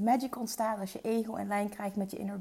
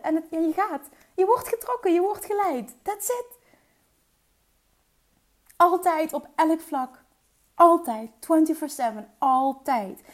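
excited; 5 semitones higher, 6 dB louder than usual. Real, not acted. Rising enthusiasm about being guided.

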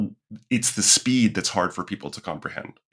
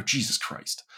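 whispered, weary groan of frustration; exasperated whisper; 3 semitones higher, 8 dB quieter, slower than usual. Retold or imitated. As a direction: breathe it out, fed up. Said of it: "And you go".

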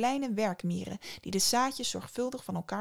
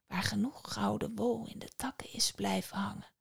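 soft rushing whisper; the river murmuring advice; river spirit whispering a warning to Psyche; whispering water voice; 5 dB quieter than usual. Acted. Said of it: "het suizende geluid van het kabbelende water".

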